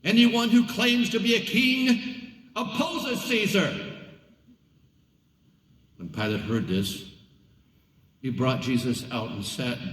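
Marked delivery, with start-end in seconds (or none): 0.0-4.0 crowd shouting. A narrator's reading. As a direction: threaten Pilate as the crowd.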